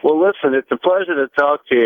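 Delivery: usual speaking voice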